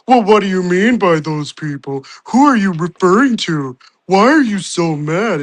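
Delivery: loud, high mocking whine; mocking nagging voice. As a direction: mimic pestering critics. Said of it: "if you say anything".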